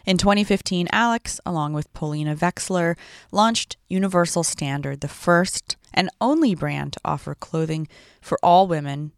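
polished podcast intro read; smooth host narration; promo read. Acted.

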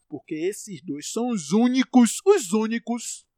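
loud, slow, furious emphasis; yelling in defense of his favorites; angry shout. Real, not acted. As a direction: drag out each word in a real rage. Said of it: "meu sotaque só aparece quando eu tô puto".

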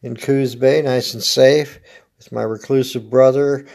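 ordinary delivery

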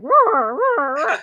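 loud dinosaur roar sound effect; imitating a button-press roar; mock roar. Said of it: "it'd be like".

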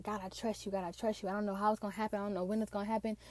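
soft, quickening plea to God; hushed retold prayer. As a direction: murmur it like a private prayer, picking up speed. Retold prayer of faithful waiting.

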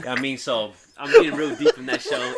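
ordinary talk, nothing marked

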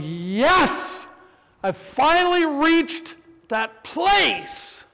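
high, slow, beaming cry of victory; giddy triumph. Retold or imitated. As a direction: crow over a small win, mock-giddy. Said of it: "I'm like".

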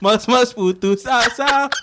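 drawn-out grand announcement; slow mock announcer. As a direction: announce each title slowly. Mock solemn naming.

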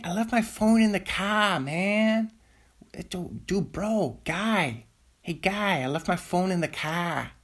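high-pitched mock Boston guy; Boston accent. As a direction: do a hammed-up Boston bro. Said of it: "You just say Boston stuff".

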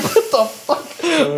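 slow, drawn-out disbelief with a laugh; stunned disbelief. Retold or imitated. Reacting to the attacker's excuse, slow stunned laugh. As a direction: stretch it out in disbelief.

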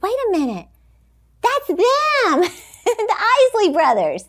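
high, excited exclamation; retold astonishment, breaking into a laugh; amazed realisation. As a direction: it suddenly dawns on you, voice leaping up. Retells sudden realisation about the band.

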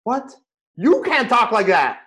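high, slowed, incredulous "what?"; disbelief. Retold or imitated. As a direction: rabbi echoing the driver in loud disbelief. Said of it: "I'm like".

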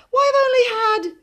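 put-on very high, loud boyish objection; indignant protest. A narrator's reading. Boy protests to his mother, indignant. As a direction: burst out in protest, then break off.

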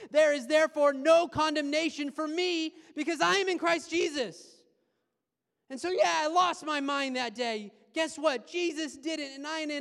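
loud, building proclamation; preaching at full force. Acted. Pastor proclaiming freedom from condemnation, loud. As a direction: declare it to the whole room.

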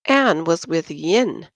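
ordinary delivery

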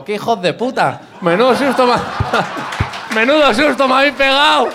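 loud, high laughing shout; high-pitched blurt after a fright; relieved outburst. Retold or imitated. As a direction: yell it out in shocked relief, laughing. Relieved outburst after scare, retold.

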